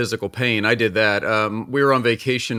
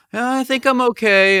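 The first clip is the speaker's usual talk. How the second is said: put-on brave little girl; 12 semitones higher, 4 dB louder than usual. Daughter with broken wrist answers.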